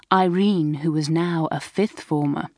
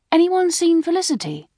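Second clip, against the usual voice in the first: high, raised searching call; high-pitched hail; 11 semitones higher, 4 dB louder than usual. A narrator's reading. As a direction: ask round a crowd for a missing sister. Calling round for missing sister.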